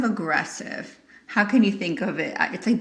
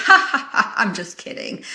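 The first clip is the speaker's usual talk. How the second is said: imitated laugh, then brush it off; mock laugh. Friend taking back an accusation, mock laughing. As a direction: laugh it away as a joke.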